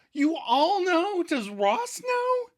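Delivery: high hammed-up panicked girlfriend; falsetto Rachel. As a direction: stretch out the alarmed question high. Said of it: "And then Rachel asks".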